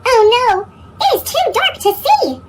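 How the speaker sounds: high, loud storybook alarm; squeaky cartoon voice. A narrator's reading. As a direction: gasp it out like a scared kid's narrator. Narrator alarm in dark cave.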